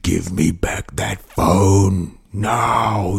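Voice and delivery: put-on slow, eerie possessed-girl voice; slow possessed-teen voice demanding the phone back; demonic Exorcist voice. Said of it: "her voice changed".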